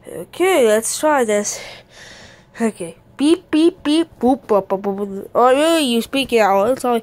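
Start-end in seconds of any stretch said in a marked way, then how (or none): 3.2-5.3 machine beeps and boops. Pretend. Child voices the cloning machine's buttons, playful machine beeping. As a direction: imitate buttons beeping, dropping in pitch.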